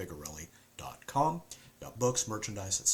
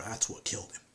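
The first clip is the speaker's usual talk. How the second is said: hushed; 4 dB quieter than usual. Acted. Dark hushed line.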